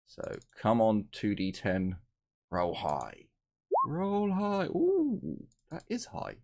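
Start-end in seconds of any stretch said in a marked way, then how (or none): 3.9-4.7 pitched way up, eager; high excited plea. Real, not acted. Dice roll. 4.7-6.4 high delighted squeal; thrilled at the roll, very high; excited. Real, not acted. Good dice roll.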